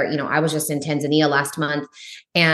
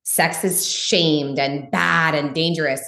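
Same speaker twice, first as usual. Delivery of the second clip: ordinary delivery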